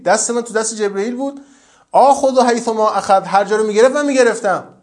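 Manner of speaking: loud, high, fervent preaching; preaching at full force. Acted. Preacher tells the congregation about the pressure of the grave, loud and fervent. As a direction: thunder the story of the Prophet and Sad.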